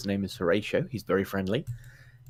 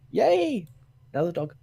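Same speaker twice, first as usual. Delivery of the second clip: excited dog; 8 semitones higher than usual. Retold or imitated. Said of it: "They just think".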